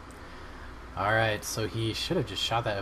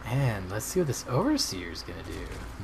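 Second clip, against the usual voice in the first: ordinary talk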